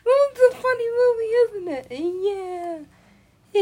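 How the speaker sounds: very high, loud, wailing character voice; put-on otherworldly voice. Voice from another dimension, high and wailing. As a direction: wail it way up high. Plays an odd transmitted voice.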